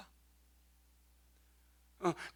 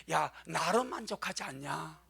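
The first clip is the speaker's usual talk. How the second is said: much softer, gentle question; soft voice of the Lord; 5 semitones lower, 10 dB quieter than usual. Retold or imitated. The Lord answering worldly thinking, softly. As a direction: let it fall to a hush. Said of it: "주님 말해".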